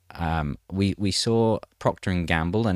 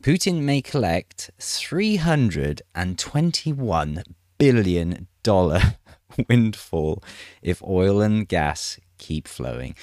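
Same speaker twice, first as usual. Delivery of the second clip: slow, drawn-out headline delivery; slow news announcer reading; slower than usual. Acted. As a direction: announce it slowly, weighting each chunk. Reads out the headline.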